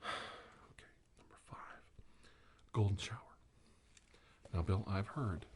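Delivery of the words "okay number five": sigh, then whisper to yourself; hushed aside while reading the list. Real, not acted. Host reading his own list, whispered.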